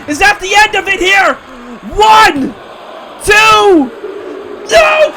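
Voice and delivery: high, shouted commentator calls; frantic play-by-play. Acted. Commentator screaming through the near falls to viewers, frantic. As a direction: yell each count like the match is ending.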